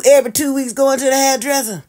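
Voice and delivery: usual speaking voice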